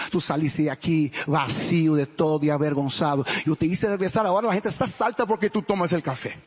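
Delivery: ordinary talk, nothing marked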